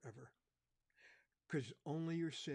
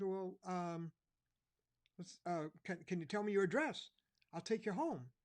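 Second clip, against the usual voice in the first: higher, louder helpful cop voice; a kindly officer trying to help; friendly officer asking a lost boy; policeman; 6 semitones higher, 6 dB louder than usual. Retold or imitated.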